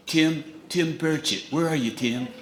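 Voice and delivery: lightly voiced president calling out; mock Trump. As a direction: do the president's voice calling his name. Imitating the president calling him.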